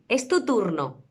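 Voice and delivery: ordinary talk, nothing marked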